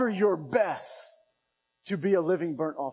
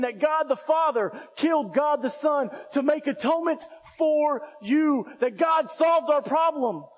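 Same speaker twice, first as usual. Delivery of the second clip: higher, louder building preacher shout; preaching at full force; 8 semitones higher, 5 dB louder than usual. Acted. Pastor rallying the congregation, rising preaching shout. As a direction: build each line, then shout it out. Sermon climax listing Jesus' work.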